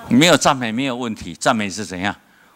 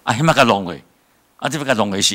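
ordinary delivery

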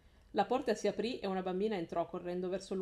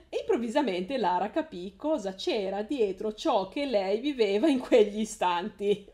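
reading through real laughter, louder; laughing while reading; 2 semitones higher, 8 dB louder than usual. Real, not acted. Reader laughs at her own stumbles, reading on laughing. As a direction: giggle your way through the sentence.

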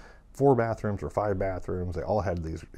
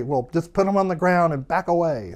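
higher, louder call to someone outside; yelling through door; 9 semitones higher, 8 dB louder than usual. Retold or imitated. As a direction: shout instructions through a closed door. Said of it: "he'd yell through the door".

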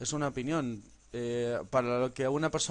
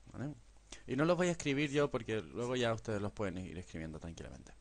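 usual speaking voice

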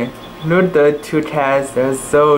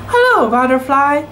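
higher, little kitten greeting; tiny kitten voice; 10 semitones higher than usual. A narrator's reading. Kitten Shidwa greeting a butterfly, higher and sweet. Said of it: "she said".

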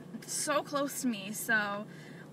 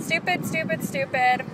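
louder, higher, fed-up repeated jab; real irritation, punched out; annoyed outburst; 3 semitones higher, 7 dB louder than usual. Real, not acted. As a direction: vent the frustration at the gas price. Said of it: "I'm kind of annoyed".

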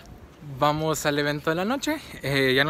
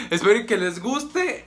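voice crack; 6 semitones higher than usual. Real, not acted. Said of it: "otro gallo".

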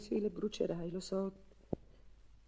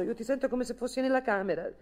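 ordinary talk

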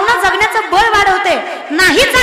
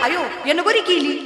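softer, slow tut-tutting aside; mock gossip; 4 semitones lower, 6 dB quieter than usual. Preacher mimics gossips about a dark bride, slow and hushed. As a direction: sneer it slowly under your breath. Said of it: "तरी म्हणतात".

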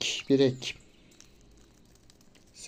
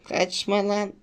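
urgent, higher calls after a fleeing fish; rising chase cries, come here, don't run; excited calling out; 5 semitones higher, 4 dB louder than usual. Real, not acted. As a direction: call the fish back, getting more worked up. Chasing a fish in game.

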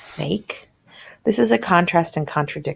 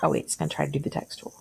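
muttered aside; 12 semitones lower, 9 dB quieter, much faster than usual. Real, not acted.

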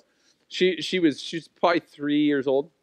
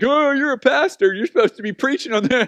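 mock teasing; 9 semitones higher, 4 dB louder than usual. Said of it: "Mostly the part about, like".

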